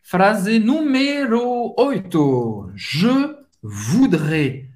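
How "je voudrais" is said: very slow, drawn-out French for learners; slow model phrase. Shown as a demonstration. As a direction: stretch each syllable for students to copy. Teacher models phrase slowly. (frase em francês bem devagar, didática)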